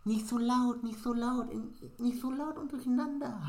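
put-on tiny woman fending off a drunk, high and pleading; small woman pleading. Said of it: "hat die dann nur gesagt".

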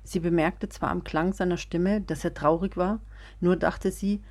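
ordinary delivery

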